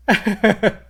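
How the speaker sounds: high, loud run of chuckles; quick burst of high laughing; rueful laughter. Real, not acted. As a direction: laugh at your own failure. Laughing at own choke.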